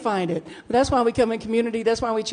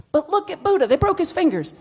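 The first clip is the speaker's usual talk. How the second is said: higher, louder indignant protest; indignant pleading; 8 semitones higher, 6 dB louder than usual. Retold or imitated. Texting her wife about the Buddha, high, loud indignant pleading. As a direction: plead your case, worked up. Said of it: "texting back pictures".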